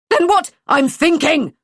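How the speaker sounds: snapped. A narrator's reading. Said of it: "David snapped".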